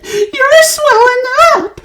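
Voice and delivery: high, loud slow scream; screamed again. A narrator's reading. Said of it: "screamed Missus Beauregard again".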